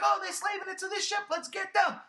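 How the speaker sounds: high, quick mock-eager Finn; imitating Finn. Mimicking Finn spotting the ship, mock-eager. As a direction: play Finn as eager and naive, half-mocking. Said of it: "Finn right off the bat was like".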